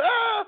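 sudden high-pitched yelp; acted yelp of surprise; mock startled cry. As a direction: let out a high "Ah!" as if grabbed. Acting out grabbing the kid.

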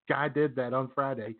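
usual speaking voice

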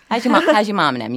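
light New Orleans yat accent, easy and chatty; a hint of yat, greeting a neighbour; casual New Orleans yat accent, lightly done. Shown as a demonstration. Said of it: "something very yat".

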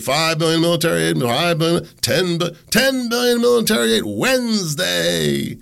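high-pitched fast-talking auctioneer calling out bids; mock auctioneer. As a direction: rattle off the bids like a hammed-up auctioneer. Mock auction of Ukraine aid.

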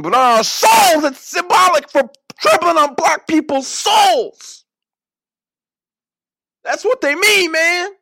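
high, loud, overblown lament; mock outrage. Outraged man explaining shoe symbolism to Penny, mock anguished fury. Acting out outraged man's rant.

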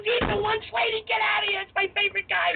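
high, excited fan imitation; freaking out. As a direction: act out a fan shrieking to everyone around. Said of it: "she starts freaking out".

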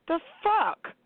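high, incredulous "The fuck?" with a falling end; a high, falling, disbelieving "The fuck?"; outraged disbelief. Real, not acted. Outraged rant about grown men.

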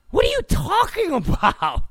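high-pitched incredulous outburst; exasperated, squeaked-up challenge. Real, not acted. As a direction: shoot up high in disbelief. Incredulous at the outrage he describes.

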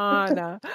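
Anna said as AH-nuh; first A of Anna as in "father"; first-vowel AH pronunciation. Shown as a demonstration. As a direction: say Anna with an open "ah". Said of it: "trying to say Anna instead of Anna".

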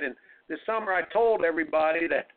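normal talking voice

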